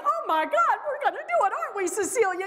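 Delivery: high, breathless gush of excitement; imitating a thrilled colleague, high and airy. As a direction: squeal it like you can't wait. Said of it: "She's like".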